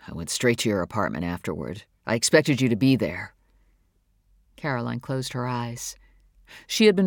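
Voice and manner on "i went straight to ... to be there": low-pitched male character voice; David, earnest man. Acted. David telling Caroline he came looking for her, low and earnest. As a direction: drop low for the man's earnest account.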